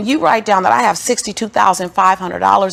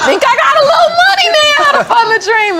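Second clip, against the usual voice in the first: much higher, louder, voice cracking with joy; crying laughing shout; 8 dB louder than usual. Real, not acted. [name] overwhelmed with joy retelling the bank visit.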